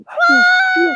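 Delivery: very high squeal; shrieked disbelief. Retold or imitated. Retells own reaction to invitation.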